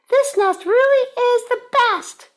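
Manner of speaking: beam it out high with joy; Missus Bird. Acted. Missus Bird proud of her nest, very high and loud. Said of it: "said Missus Bird".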